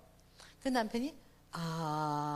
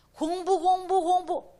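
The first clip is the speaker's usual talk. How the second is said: nagging parent; 9 semitones higher, 10 dB louder, slower than usual. Retold or imitated.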